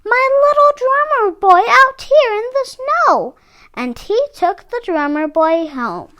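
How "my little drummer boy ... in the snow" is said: put-on high excited child's voice; a child delighted to find a lost toy; amazed child. A narrator's reading. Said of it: "the child cried with amazement".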